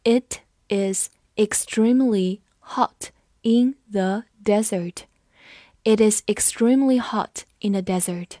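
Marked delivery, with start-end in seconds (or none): 0.0-5.1 measured, one word at a time; slow read-along. Shown as a demonstration. Teacher leads students through the sentence, slow and separated. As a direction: read slowly with a gap after each word. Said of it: "我们慢读一遍".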